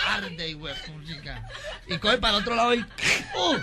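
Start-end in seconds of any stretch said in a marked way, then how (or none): 0.1-1.5 pained whisper. Acted. Drunk in pain. 2.8-3.6 pained yelp. Acted. Drunk hurt again.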